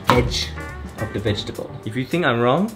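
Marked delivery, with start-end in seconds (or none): none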